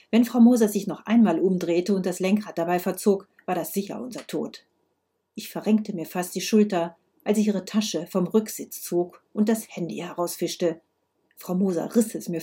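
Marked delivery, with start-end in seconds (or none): none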